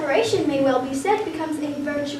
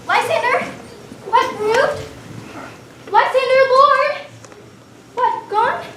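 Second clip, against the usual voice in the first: calling out anxiously; 6 semitones higher, slower than usual. Acted. Hermia calls for the vanished Lysander, rising alarm. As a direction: call into the dark for him.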